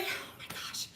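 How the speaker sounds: breathy whisper under the breath; whispered aside. Real, not acted. Aside after mentioning her surgery.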